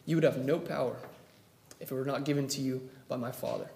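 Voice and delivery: Jesus quietly answering Pilate; calm reply. Retold or imitated. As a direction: answer without a trace of fear.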